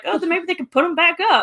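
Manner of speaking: high, fast, laughing naive hope. Retold or imitated. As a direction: play it naive and hopeful, laughing. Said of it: "I was like".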